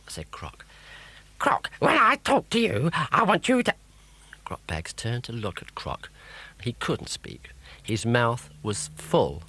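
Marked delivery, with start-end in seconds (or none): 1.4-3.7 high, sharp scolding witch; a witch telling off her sidekick. A narrator's reading.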